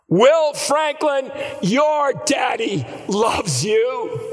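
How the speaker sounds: crying out. Retold or imitated. Father calling to his son across the driveway, crying out. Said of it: "he cried out".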